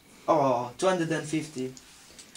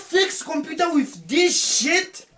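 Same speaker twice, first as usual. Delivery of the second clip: incredulous demand; 9 semitones higher, 3 dB louder, slower than usual. Acted. Incredulous at his tools.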